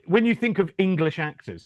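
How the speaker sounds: ordinary talk, nothing marked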